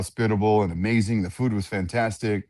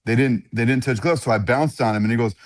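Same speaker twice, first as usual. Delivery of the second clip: usual speaking voice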